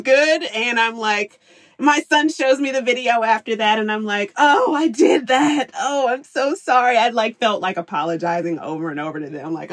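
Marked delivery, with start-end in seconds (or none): 4.4-7.0 slow, higher, sheepish sorry; rueful apology. Retold or imitated. Mom watching her son's video of her yelling, sheepish and sorry. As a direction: cringe and apologize.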